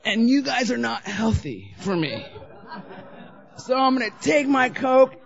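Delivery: high, loud, slow self-righteous proclamation building to a shout; storming off with the coke; grand mock exit. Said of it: "I went like this".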